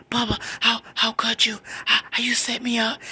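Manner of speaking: high, stammered tearful plea; acted crying. Crying out boyfriend's name on three-way, acted tearful. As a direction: plead as if choking on tears. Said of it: "I'm crying".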